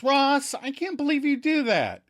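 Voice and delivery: very high mock telling-off; shriek-scold a brother, hammed up; high, stretched indignant reproach. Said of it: "Monica scolds".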